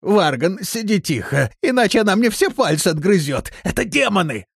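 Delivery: high, urgent, fearful warning; frightened, high-pitched pleading; panicked warning. Acted. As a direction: warn your brother in terror. Frightened man warns his brother.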